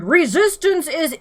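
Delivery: loud, high guard bellow. A narrator's reading. The Vogon guard bellowing his favourite phrase, loud and high. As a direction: fill the lungs and bellow it. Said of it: "and bellowed".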